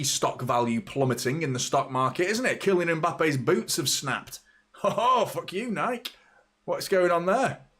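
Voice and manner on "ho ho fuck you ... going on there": high-pitched comic indignation at the brand; mock outrage. Player confronting Nike over snapped boots, mock outrage. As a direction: open on a high laugh, then demand answers.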